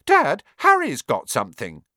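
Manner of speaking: put-on high, loud tattling boy; sudden outburst. A narrator's reading. Son telling dad about his cousin's letter, sudden outburst. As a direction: blurt it out to tell on someone. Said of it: "said Dudley suddenly".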